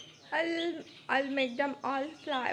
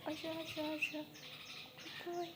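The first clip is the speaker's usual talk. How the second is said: soft calling; 3 semitones higher, 8 dB quieter, faster than usual. Real, not acted. Softly calling the dog.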